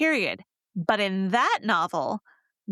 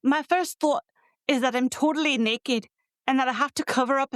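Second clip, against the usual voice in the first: higher-pitched amateur Scottish accent; 4 semitones higher than usual. Shown as a demonstration. Podcaster reading a Scottish heroine's embarrassed moment aloud, Scottish accent. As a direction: read it out in a careful Scottish accent. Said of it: "let me see if I can do a Scottish accent".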